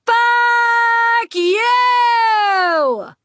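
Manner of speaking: long, drawn-out high scream; furious yell. A narrator's reading. Woman losing it at rude rich passenger, furious yell. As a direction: let loose a huge self-righteous roar, stretching each word. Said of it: "and I yelled".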